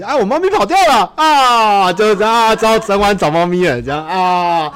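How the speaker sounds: high loud whining; loud exaggerated whine. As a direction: wail it, hammed-up and high. Acted dialogue, cat ran off.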